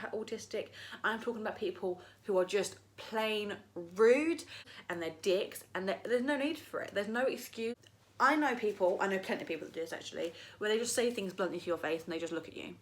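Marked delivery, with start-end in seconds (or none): none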